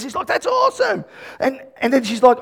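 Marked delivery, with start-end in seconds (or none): 0.3-1.0 high-pitched, light exclamation; softly squealed mock amazement; retold delight. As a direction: mimic an onlooker's thrilled reaction. Retold onlooker's delighted reaction.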